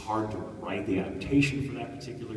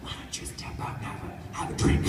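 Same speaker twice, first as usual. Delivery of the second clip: gentle coaxing director; 4 dB louder than usual. Retold or imitated. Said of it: "the director was like".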